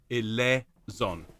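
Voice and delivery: "eleison" drawn out slowly as e-LEH-zon; correct pronunciation. Shown as a demonstration. Choir leader spelling out the sound, "eleison" slowly as e-LEH-zon. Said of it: "as if it's eleison".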